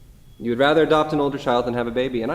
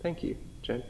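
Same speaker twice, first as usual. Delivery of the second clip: choked thanks; 4 semitones higher, 13 dB quieter than usual. Acted. Paul moved, receiving letter.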